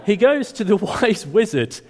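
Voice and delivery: slow words broken by a chuckle; laughing. Real, not acted. Preacher laughs while resuming the story. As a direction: still giggling as he gets back on track. Still laughing after slip.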